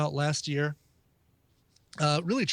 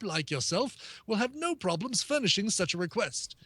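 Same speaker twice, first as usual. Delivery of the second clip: higher-pitched, hammed-up posh English accent; 7 semitones higher than usual. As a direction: read it like a pompous English gentleman, posh English accent throughout. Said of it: "I have to do this in a posh English, English accent".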